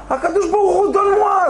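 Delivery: urgent high prayer; pleading. Retold or imitated. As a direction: cry out to God for help. Retold plea to God.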